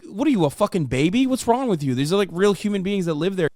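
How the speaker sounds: normal talking voice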